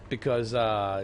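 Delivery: normal talking voice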